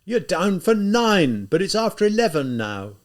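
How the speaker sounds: loud, high, slow scolding; outraged. Acted. Outraged lieutenant scolding Raskolnikov for lateness, loud. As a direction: drag out the reproach in rising outrage. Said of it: "growing more and more outraged".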